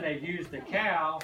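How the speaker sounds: ordinary delivery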